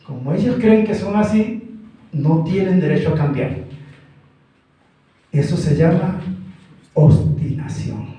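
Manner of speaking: ordinary delivery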